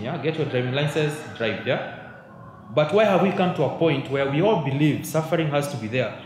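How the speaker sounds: ordinary talk, nothing marked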